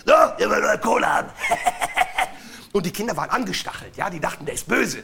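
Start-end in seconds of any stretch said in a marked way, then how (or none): 0.1-1.3 fast, loud robber voice; Hotzenplotz voice. Acted. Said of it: "mit einer Hotzenplotz-Stimme". 1.3-2.7 high, shouted villain laugh; evil robber laugh. Acted. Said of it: "mit einer Hotzenplotz-Stimme".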